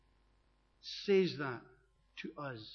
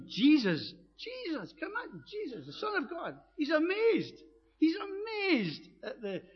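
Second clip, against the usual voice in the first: amazed; 11 semitones higher, 3 dB louder than usual. Real, not acted. Preacher marvels Jesus amazed.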